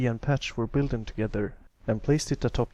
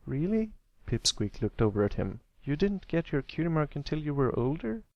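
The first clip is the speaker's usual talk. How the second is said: put-on a kid admiring his big brother's mark; envious young colt; 5 semitones higher than usual. Little brother telling big brother he wants a cool mark. Pipsqueak voiced.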